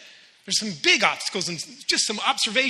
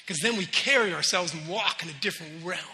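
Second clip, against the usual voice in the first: ordinary talk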